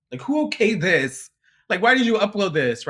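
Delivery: loud, high, appalled questioning; exasperated outburst. Retold or imitated. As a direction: demand to know who allowed it. Retold outburst at the video.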